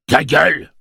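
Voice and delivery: sudden high, loud yell; vociferated. A narrator's reading. Gâcheur silencing Hoots, yelled in rage. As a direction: cut them off with a furious shout. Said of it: "vociféra Gâcheur".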